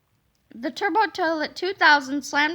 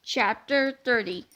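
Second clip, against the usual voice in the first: ordinary delivery, nothing marked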